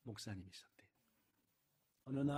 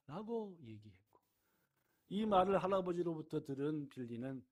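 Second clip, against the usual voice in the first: ordinary delivery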